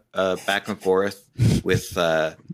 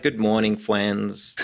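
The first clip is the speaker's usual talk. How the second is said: ordinary talk, nothing marked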